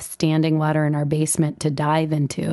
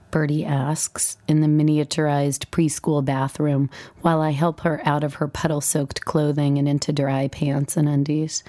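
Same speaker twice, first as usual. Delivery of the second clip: ordinary delivery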